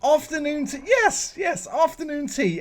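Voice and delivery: high-pitched, delighted confirmation; excited agreement. Real, not acted. Host to his guest who guessed the English tradition, excitedly agreeing. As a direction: seize on the answer, pitch leaping up.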